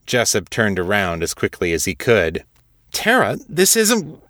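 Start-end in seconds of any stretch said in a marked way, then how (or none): 2.9-4.2 startled protest. Acted. Jessup startled, cut off.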